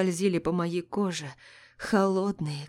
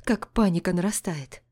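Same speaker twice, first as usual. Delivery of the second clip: ordinary delivery, nothing marked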